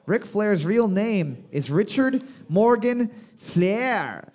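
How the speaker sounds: slow deliberate question reading; slow quiz read. Real, not acted. Quiz host reading a question slowly. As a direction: read each name slowly for the teams.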